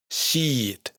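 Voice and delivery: "Sheet" with a long "ee"; long closed i. Shown as a demonstration. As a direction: stretch the tight vowel in "Sheet". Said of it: "una i larga que siempre es cerrada".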